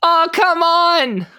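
put-on Australian accent. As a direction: call it from a mile away in an Aussie voice. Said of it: "just being like".

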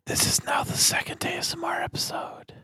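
playful ASMR whisper. Acted. Joining in the whispering joke.

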